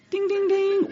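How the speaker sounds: high, sung-out "ding, ding, ding"; game-show bell. Acted. Preacher mocking a game-show prize moment, chiming bell imitation.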